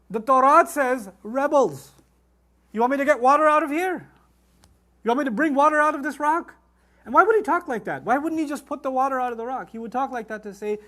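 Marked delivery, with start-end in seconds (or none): 1.2-6.5 exasperated, raised-voice challenge; acted rebuke, louder and higher; Moses. Moses challenging his people at rock.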